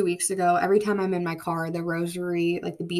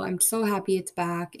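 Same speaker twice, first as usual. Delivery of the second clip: normal talking voice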